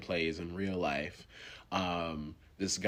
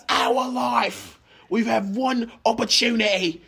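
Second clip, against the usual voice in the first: high, loud mock-melodramatic monologue; hammed-up cringey movie speech, laughing through it; 13 semitones higher, 6 dB louder than usual. Co-host mocking an actor's big monologue, high and hammy. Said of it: "two very cringe-filled monologues".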